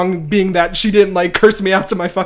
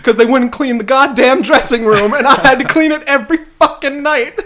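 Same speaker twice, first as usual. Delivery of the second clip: exasperated rant; 5 semitones higher, 4 dB louder than usual. Real, not acted. Ranting about hated castmates.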